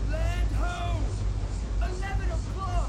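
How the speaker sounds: high call from far off; distant lookout call. Acted. Lookout calls the sighting to the crew from afar. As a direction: sing out from the masthead.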